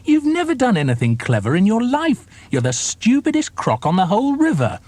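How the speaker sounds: high, mocking little croc voice; small crocodile. A narrator's reading. Small croc taunting the enormous crocodile, high and mocking. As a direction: sneer up at the big one in a high voice. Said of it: "cried the not-so-big one".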